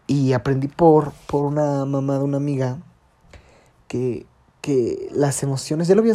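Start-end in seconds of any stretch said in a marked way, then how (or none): none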